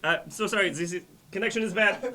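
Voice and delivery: high, loud cutting in; flustered interruption. Acted. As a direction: drown out your parent to end the call. Embarrassed son cuts off the call.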